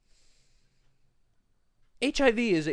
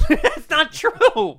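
laughing through the words; disbelieving laugh while talking; 7 dB louder, much slower than usual. Real, not acted.

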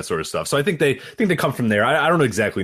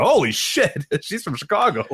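surprised; 7 semitones higher, slower than usual. Retold or imitated. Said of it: "you went".

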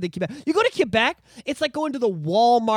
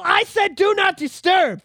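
high, slow, emphatic command; exasperated scolding; 10 semitones higher, 4 dB louder, slower than usual. Real, not acted. Podcaster scolding Google for interrupting the show, exasperated. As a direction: scold the device word by word.

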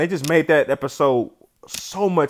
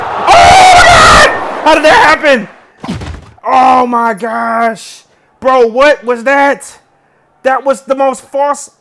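much higher, loud and slow disbelief; stunned shouting; 7 semitones higher, 8 dB louder, much slower than usual. Real, not acted. Gamer yelling in disbelief at the crazy play, stunned. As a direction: stretch out the astonishment.

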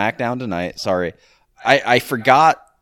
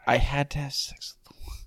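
whispering; 3 semitones higher, 6 dB quieter than usual. Real, not acted. Mutters the awkward question to himself.

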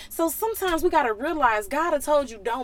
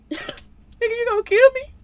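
high, loud alarmed cry of fear; panicked shout; 11 semitones higher, 6 dB louder than usual. Acted. Donkey yelling at the armed angel in panic. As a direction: yelp it like you just saw a sword.

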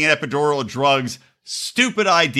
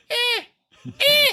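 high squeaky eh, eh; mock shrug sound. Husband dodging his wife's point, high mock eh. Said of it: "And I'm like".

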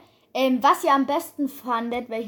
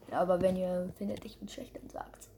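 whispering; 7 semitones lower, 13 dB quieter than usual. Real, not acted. Asking viewers for honest feedback.

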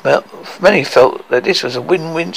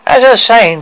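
high, loud interruption; 9 semitones higher, 7 dB louder than usual. Acted. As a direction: take the floor back loudly. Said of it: "Tricky said loudly".

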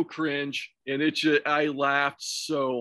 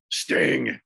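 mock wrestling promo. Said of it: "Who is that?".

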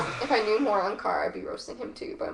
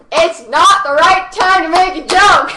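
exasperated outburst; 6 semitones higher, 9 dB louder, slower than usual. Retold or imitated. Retelling her anger.

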